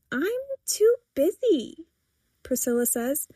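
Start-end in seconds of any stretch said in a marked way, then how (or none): 0.1-1.9 slow, high-pitched complaint; teen protest. A narrator's reading. Daughter explaining her schedule to mother, protesting. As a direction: draw it out, pitched up.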